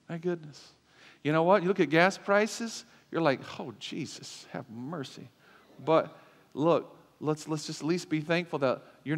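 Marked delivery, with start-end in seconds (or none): 3.6-5.3 weary sigh. Retold or imitated. Reacting to gas prices.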